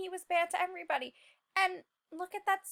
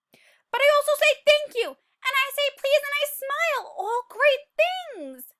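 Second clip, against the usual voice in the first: high, loud, beaming delivery; proud and bright; 10 semitones higher, 9 dB louder than usual. A narrator's reading. Seed boasting about manners to the children, bright excitement. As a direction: sound thrilled to be good.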